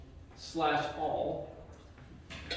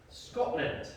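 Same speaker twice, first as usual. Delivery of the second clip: attempted Scottish accent; 5 dB quieter than usual. Shown as a demonstration. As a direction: have a rough go at a Scottish accent. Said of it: "I can't do a Scottish accent very well".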